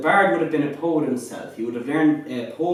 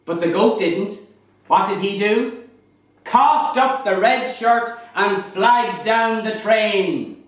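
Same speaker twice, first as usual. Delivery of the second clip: higher, lively Irish fireside storytelling; lilting irish storyteller; 6 semitones higher, 4 dB louder than usual. Acted. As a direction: spin a comic tale by the fire, building to the punchline. Shanachie performs a comic goat tale.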